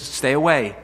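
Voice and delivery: loud stern command to keep back; stern warning. Acted. God warning Moses away, a loud stern command. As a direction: warn him off firmly.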